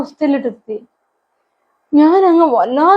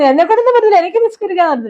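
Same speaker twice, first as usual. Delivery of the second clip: higher, insistent refusal of a son; son's flat refusal; 7 semitones higher, faster than usual. Retold or imitated. Son refusing his mother's request to pray, higher and insistent. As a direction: push it away, a son saying no.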